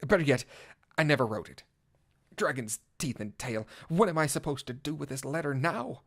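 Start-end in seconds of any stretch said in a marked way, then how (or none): none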